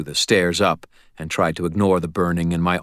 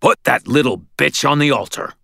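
higher, louder choked rage; strangled fury; 8 semitones higher, 4 dB louder than usual. A narrator's reading. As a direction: force the order through fury. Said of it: "his voice strangled with fury".

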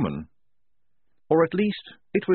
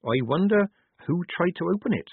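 lightly voiced soldier, pitched up; a hint of character, higher than narration; Polly; 8 semitones higher than usual. A narrator's reading. Soldier wondering about the sealed crypt, lightly voiced. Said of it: "said Polly".

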